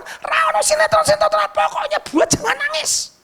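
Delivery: high shouting teacher, slowing at the end; scolding teacher. Retold or imitated. Teacher shouting high at pupils to stop crying. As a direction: yell at the class to stop crying.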